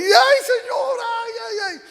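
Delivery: high falsetto cries with a laugh in them; hammed-up panicked wail. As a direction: wail like a man dangling in the dark. Hombre colgado del árbol gritando. (lamento agudo y exagerado)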